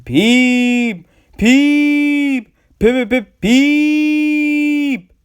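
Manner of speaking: very high, loud whistle shrieks; a ghostly engine whistling shrilly to scare; shrieking peeps. A narrator's reading. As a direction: shriek each peep like a steam whistle. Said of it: "he shrieked".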